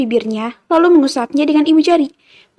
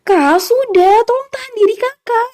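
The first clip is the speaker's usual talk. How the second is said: high-pitched sisterly plea; high, urgent entreaty; pleading; 10 semitones higher than usual. A narrator's reading. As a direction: beg your brother to hold back. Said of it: "pinta Chelsea".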